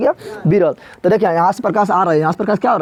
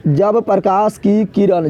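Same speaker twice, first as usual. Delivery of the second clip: slow dictation; much slower than usual. Shown as a demonstration. Teacher dictating the definition to the class, slow and deliberate. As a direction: spell out जब प्रकाश की किरण slowly, word by word. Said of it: "तो क्या बोलेगा?".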